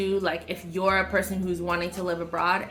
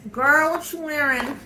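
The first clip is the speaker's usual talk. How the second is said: put-on higher, louder sassy girlfriend voice; imitating the ladies; 8 semitones higher, 6 dB louder than usual. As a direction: ask it like an impressed friend. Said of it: "all the ladies in the picnic gonna be like".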